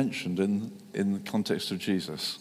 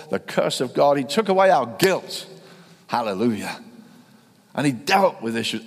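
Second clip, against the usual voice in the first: louder, building, emphatic sermon; preaching at full force; 5 dB louder than usual. Acted. Preacher to his congregation about the cross, louder building preaching. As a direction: lift the crowd to the cross.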